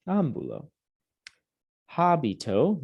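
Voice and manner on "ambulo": Ambulo as "AHM-boo-lo", falling; corrected ambulo. Shown as a demonstration. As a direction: say Ambulo with no y-glide. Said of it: "we say ambulo".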